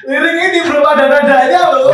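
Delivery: catchphrase announcement. Pretend. Repeats the title when cued.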